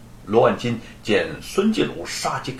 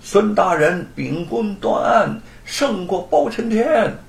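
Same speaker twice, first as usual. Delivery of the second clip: slow, higher ringing cheer; loud acclaim; 6 semitones higher, slower than usual. A narrator's reading. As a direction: hail the judge together, slowly. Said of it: "高呼".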